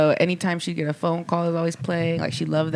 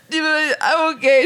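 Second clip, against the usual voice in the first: choked up; 9 semitones higher, 4 dB louder than usual. Real, not acted. Retelling crying at the bar.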